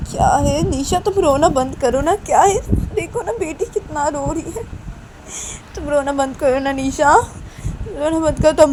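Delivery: high-pitched irritated plea; exasperated scolding. Acted. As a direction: beg a crying sister to stop, losing patience. Manisha tells Nisha to stop crying. (परेशान होकर ऊँची आवाज़ में टोकना)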